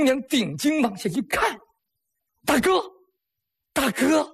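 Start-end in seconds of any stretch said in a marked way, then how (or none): 2.4-4.3 alarmed calling. Acted. Sister calls fallen brother.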